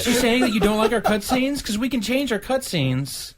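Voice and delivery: high, laughing needy voice; pleading Square voice. Acted. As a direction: bargain like a desperate ex. Voicing Square in skit.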